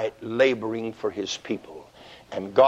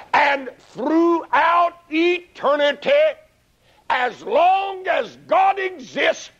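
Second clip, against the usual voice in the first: preaching at full force; 10 semitones higher than usual. Real, not acted. Preaching hell.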